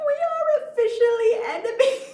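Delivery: high and loud, cracking up mid-line; laughing through it. Real, not acted. Reading her message aloud and cracking up with laughter. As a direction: read it while breaking into laughter. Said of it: "who talks like that".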